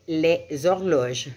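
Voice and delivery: "les horloges" linked with a Z sound; liaison. Shown as a demonstration. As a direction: carry a Z from "les" into "horloges", silent H. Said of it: "je dois faire la liaison".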